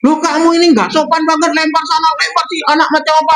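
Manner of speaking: high, rapid-fire nagging, hammed up; mother scolding. Acted mother scolding her messy son.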